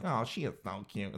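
hushed, lightly voiced admiring onlooker; cooing stranger. Stranger noticing someone's kid, hushed admiring coo. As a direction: drop to a soft little aside. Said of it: "strangers are gonna look over like".

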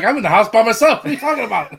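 hammed-up Doug insisting he's alone; mock Doug voice. Imitating Doug in scene.

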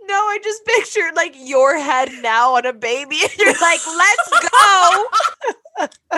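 wheezing with laughter; breathless laughing wheeze; wheezy voice. Real, not acted. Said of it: "I can't breathe".

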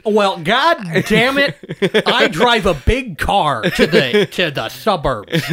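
slow, drawn-out fake American accent; Brit faking American. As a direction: put on a laboured American voice. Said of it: "a British person trying to do an American voice".

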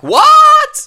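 mock shriek, very high and very loud; shocked what. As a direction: imitate Georgina's stunned outburst. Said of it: "Georgina's reaction".

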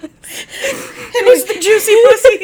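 high, slow, laughing through the words; cracking up mid-sentence. Real, not acted. Laughing retelling of the night out.

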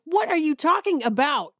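high, baffled question; lost and exasperated; incredulous. Retold or imitated. Host baffled by anesthesia trivia, high and incredulous. I react to Civil War facts.

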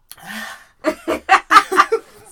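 high loud laugh bursting out; loud burst of laughter. Real, not acted. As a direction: crack up loudly. Bursts laughing after interruption.

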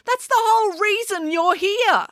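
high, slow, emphatic complaint; drawn-out exasperation; exasperated retort. A narrator's reading. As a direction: hammer it home indignantly, pitch up. Heated quarrel after a dunking.

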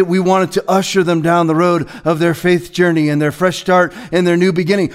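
ordinary talk

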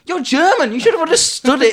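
high, loud incredulous outburst; mock exasperated scolding. Fan scolds the German henchman from the film, mock exasperated. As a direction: scold him in comic disbelief.

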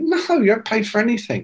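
quick, high-pitched matter-of-fact reply; brisk retold assurance; retold dismissive answer. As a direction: brush the question off as obvious. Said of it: "And he went".

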